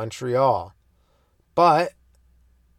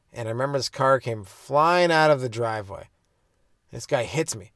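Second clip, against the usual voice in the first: ordinary talk, nothing marked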